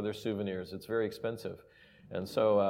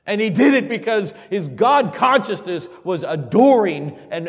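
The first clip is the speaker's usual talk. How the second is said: rising, louder and louder preacher's climax; building preaching; 6 semitones higher, 13 dB louder than usual. Acted. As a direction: preach it up to full force, then ease off at the end.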